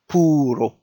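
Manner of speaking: "puro" joined up as "poo-ro"; without the jenkem. Shown as a demonstration. Teacher showing the word without jenkem, "puro" in one run. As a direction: say "puro" starting straight on the P, no "ep". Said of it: "as if there isn't here".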